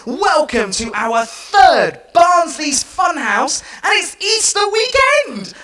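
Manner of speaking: big cheerful host greeting; excited show host. Real, not acted. Kids' show host opening greeting.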